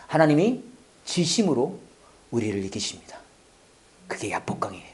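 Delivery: low, very soft, with weighty pauses; hushed solemn preaching. Real, not acted. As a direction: let it sink almost to a whisper. Hushed solemn point in sermon. (낮고 조용하게 끊어 말하는 설교)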